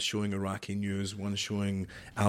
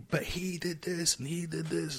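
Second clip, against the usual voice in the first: mock guards' protest; 9 semitones higher, 4 dB quieter than usual. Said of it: "screaming in Arabic".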